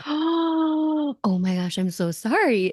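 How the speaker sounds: high, drawn-out opening, dropping to normal; mock shocked apology. Prankster re-enacts apologising to her furious teammate, mock dismay.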